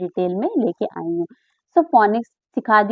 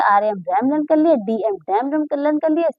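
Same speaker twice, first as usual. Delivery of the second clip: ordinary talk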